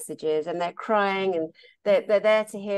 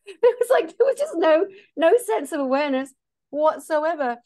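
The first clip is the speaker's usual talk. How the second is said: laughing; 8 semitones higher, 5 dB louder than usual. Real, not acted. Still laughing about it.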